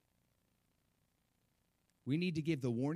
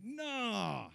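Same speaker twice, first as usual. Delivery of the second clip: drawn-out dismissive "nah", pitched up; Peter; 8 semitones higher than usual. Retold or imitated. Peter refusing to hear Jesus's warning, imitated dismissal. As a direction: imitate someone waving off a warning with a long nah. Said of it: "Peter's like".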